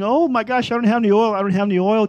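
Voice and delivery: high, loud mock panic; panicked scramble. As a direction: cry out like a foolish virgin without oil. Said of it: "everyone else is scrambling".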